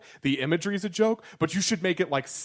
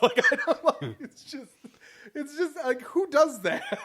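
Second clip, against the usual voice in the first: high voice, talking through laughter; helpless laughter; 11 semitones higher than usual. Real, not acted. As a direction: squeak out the question while cracking up. Still laughing at his own joke.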